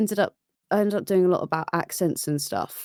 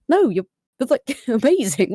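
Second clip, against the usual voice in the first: higher-pitched, laughing insistence; protesting reassurance; 7 semitones higher, 3 dB louder than usual. Retold or imitated. Friend contradicting someone's self-criticism, protesting with a laugh. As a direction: imitate a friend protesting, voice lifted.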